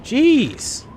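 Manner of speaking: high-pitched, exasperated protest; exasperated scolding. Real, not acted. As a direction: scold someone who's blowing your cover. Scolding game character who keeps yelling.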